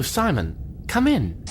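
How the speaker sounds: ordinary talk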